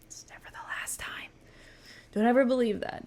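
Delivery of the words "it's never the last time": hushed knowing whisper; breathy aside to the viewers. Real, not acted. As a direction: lean in and whisper it like a secret. Knowing warning about the show.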